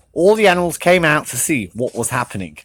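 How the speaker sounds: ordinary delivery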